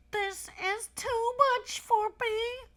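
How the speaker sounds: put-on high, slow nasal child voice; through his nose. Elephant's child speaking through his stretched nose. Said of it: "he said through his nose".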